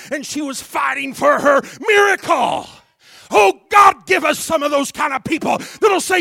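loud, high shouted preaching; full-force preaching. Real, not acted. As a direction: pound every phrase at the congregation.

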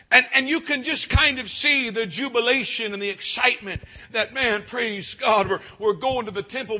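high, loud jubilant preaching; excited preaching. Acted. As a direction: let the joy carry you up.

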